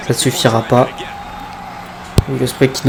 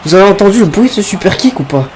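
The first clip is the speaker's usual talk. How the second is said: excited; 7 semitones higher, faster than usual. Real, not acted. Commentator reacts to loud kick.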